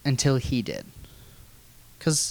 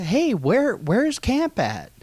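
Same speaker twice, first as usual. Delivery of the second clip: higher, slow teasing question; uncle's voice; 6 semitones higher, slower than usual. Retold or imitated. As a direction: drag out the quiz like a sly uncle. Uncle quizzing again, drawn out.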